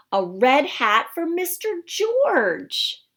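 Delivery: delighted wonder. Acted. Reader's delight at red hat.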